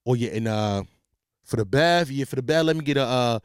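very low put-on rapper voice. Shown as a demonstration. Customer adding a drink to his order, in a deep voice. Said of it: "I turn into [name]".

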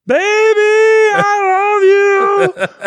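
loud drawn-out love cry; yelled out. Retold or imitated. Patient yelling to his girlfriend across the recovery hall. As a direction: bellow it across the hall, stretched out. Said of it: "I just yelled out".

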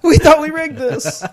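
high, half-laughing protest; mock dismay. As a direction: wail like a baffled, cheated schemer. Acting outraged Boston survey voters.

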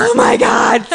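very high, loud thrilled squeal. Retold or imitated. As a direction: squeal with delight. Excited reaction retold.

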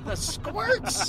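incredulous. Retold or imitated. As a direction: repeat it in disbelief. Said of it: "and I was like".

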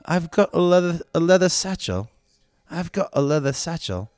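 beautiful-voiced British actor impression; British accent. Ben Kingsley asking for his bag on a plane, British accent. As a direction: put on a refined English accent. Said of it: "this guy's gonna do the accent. This beautiful voice".

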